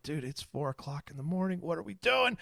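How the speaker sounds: hushed yell at the dog; whisper yelling. Retold or imitated. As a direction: shout under your breath at a puppy. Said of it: "whisper shouting at him".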